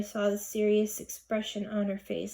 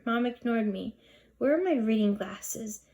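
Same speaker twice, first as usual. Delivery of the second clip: usual speaking voice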